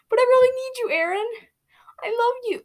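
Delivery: put-on high pleading girl voice; begging. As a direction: beg him not to go. Aphmau begs Aaron.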